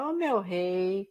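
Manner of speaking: slow, stretched mock Bahian; imitated Bahian drawl. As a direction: drag the vowels in a caricatured Bahian lilt. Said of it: "ainda faz com a entonação".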